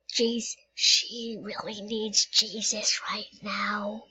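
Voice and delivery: slow snide whisper behind her back; a sly whispered dig; whispered aside. Pretend. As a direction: mutter it so she can't hear. Snide aside about passenger.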